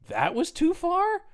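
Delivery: high, slow, disbelieving question; incredulous. Retold or imitated. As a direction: stretch it out in mock disbelief. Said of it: "Landis goes".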